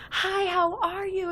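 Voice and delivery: high, drawn-out excited hello; slow, high-pitched enthusiastic welcome; energetic greeting. Retold or imitated. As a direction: imitate a bubbly, energetic greeting. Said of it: "he was just so energetic".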